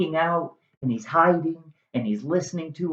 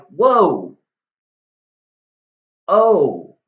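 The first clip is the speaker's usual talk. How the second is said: loud startled gasp of surprise; startled whoa; 3 semitones higher, 7 dB louder than usual. Acted. Juliet startled as Romeo bursts out, a loud whoa. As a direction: jump back, caught off guard.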